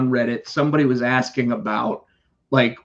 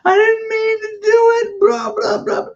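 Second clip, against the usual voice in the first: much higher, mock-tearful child pleading; crying kid. Kid caught by a fake police call, high mock-tearful pleading. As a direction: imitate a scared kid begging, half-mocking. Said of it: "they would be crying on the".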